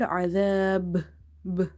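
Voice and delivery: azab slowly, with a bouncing final b; long "aa" in azab, popped b; qalqala. Shown as a demonstration. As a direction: stop on azab and let the b echo. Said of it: "like this".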